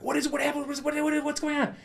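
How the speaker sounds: high, loud, frantic rush of questions; panicked. Retold or imitated. Man waking terrified in tent to his partner, panicked. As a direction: yelp questions in alarm, tripping over the words.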